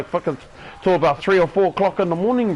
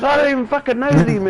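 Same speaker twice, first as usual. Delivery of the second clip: higher, incredulous retort; indignant wife; 8 semitones higher, faster than usual. Retold or imitated. Wife protesting she doesn't know the cousins, high and indignant. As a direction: fire back in disbelief.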